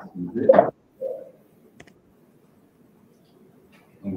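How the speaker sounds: distant, off-mic voice; speaking away from the microphone; far from mic. Real, not acted. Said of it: "[name], on entend de loin".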